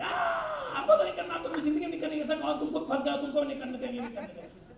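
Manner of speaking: hammed-up refusal, starting on a high wail; mock outcry that sinks and fades away; red-light naysayer. Negative prospect refuses the business outright, hammed-up protest. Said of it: "कुछ red light मिलेंगे".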